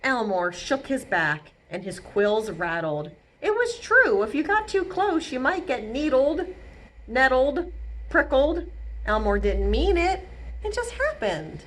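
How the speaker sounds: gentle read-aloud narration; storybook reading. A narrator's reading. Reading Elmore's story aloud to children, gently. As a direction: tell it like a bedtime story. Reading picture book to kids.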